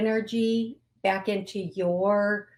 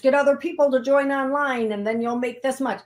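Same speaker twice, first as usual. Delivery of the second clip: a hammed-up, higher, louder sales pitch; mocking MLM pitch; 5 semitones higher, 5 dB louder than usual. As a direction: parody a pushy online recruiter. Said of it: "No, it is not an M L M".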